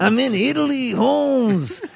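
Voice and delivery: high mock-Mexican accent passed off as foreign; hammed-up Chicano-style accent; badly faked accent.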